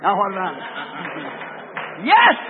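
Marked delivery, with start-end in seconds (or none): 2.0-2.4 sudden high, loud Yes; a preacher's cry of agreement; shouted yes. Real, not acted. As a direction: burst out with an exultant shout. Preacher affirms to congregation.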